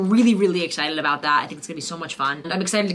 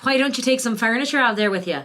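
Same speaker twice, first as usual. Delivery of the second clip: the question again in Western Irish, a little more confident; Western Irish accent; 5 semitones higher than usual. Shown as a demonstration. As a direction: repeat the question, keeping the Western Irish lilt. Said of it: "that's my Western Irish".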